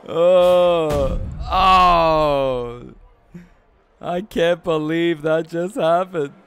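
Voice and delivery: loud amazed exclaim; stunned disbelief. Real, not acted. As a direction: react like you cannot believe it. Reacting in disbelief.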